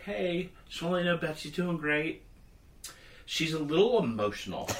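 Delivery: high, light, chirpy nurse voice. Retold or imitated. Nurse calling husband after surgery, cheery high voice. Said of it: "she's like".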